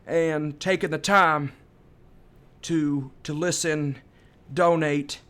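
drawling presidential address with long pauses; George W. Bush. Shown as a demonstration. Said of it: "doing a George W. Bush impersonation".

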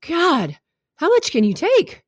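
high-pitched exasperated plea; pained, high exclamation on someone's behalf. Acted. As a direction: cry out in sympathy, voice pitched high. Voices viewer's pity for character.

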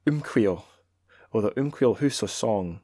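measured, declaiming read of a Scots poem; poetry reading in Scots. Acted. Reading Scots poetry to listeners, lightly declaimed. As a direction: read the Scots verse aloud with relish. Reads Scots translation aloud.

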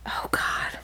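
breathy whisper while searching memory; whispered frustration; whispered oh God. Real, not acted. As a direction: mutter it under your breath, stuck. Straining to recall a book.

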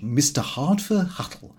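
ordinary talk

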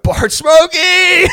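loud, high, drawn-out holler; hammed-up drunken yell. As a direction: blast the catchphrase out, stretched long. Jay blurts catchphrase at party greeting.

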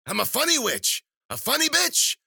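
very high, loud crowing; wild, screechy delighted crone; gloating witch. Acted. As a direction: shriek each boast with mounting glee. Witch revels in her joke.

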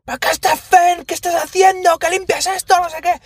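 high shrill mock scolding mother; imitating the yelling neighbour. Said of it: "Le pega unas broncas a sus hijos".

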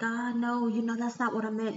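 mock flustered take-back; friend backpedaling. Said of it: "she was like".

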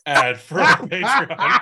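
high, slow hammed-up ad voice; mock announcer. Host mock-announcing a Patreon ad to co-hosts, hammed-up. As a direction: sell the Patreon like a cheesy announcer.

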